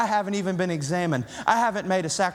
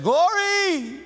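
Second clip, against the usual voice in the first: mock shout of glory; 12 semitones higher than usual. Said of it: "elder imagines shouting glory".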